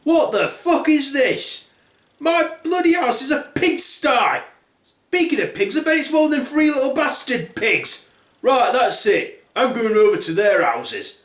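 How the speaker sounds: high-pitched outraged rant; Big Bad Wolf. Wolf finding his house a pigsty, furious. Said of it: "the big bad wolf then goes in and he then says".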